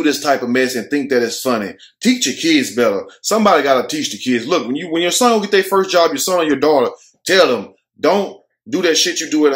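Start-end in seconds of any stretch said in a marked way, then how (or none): none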